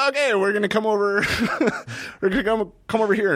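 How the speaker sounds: amused, high-pitched imitation; mock group announcement. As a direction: put on a high voice, laughing midway. Retold group quote, breaking into laughter.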